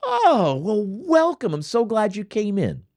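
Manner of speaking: high, sing-song server greeting; bubbly server. Retold or imitated. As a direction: chirp it like a peppy waiter. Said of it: "And they say".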